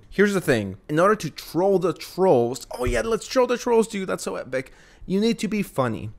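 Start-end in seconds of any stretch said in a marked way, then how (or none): 2.8-4.7 much higher mock hype voice, sarcastic; mocking dude-bro. Mocking the YouTuber's troll-the-trolls bit in a higher, snide bro voice. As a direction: parody a try-hard bro hyping his own bit.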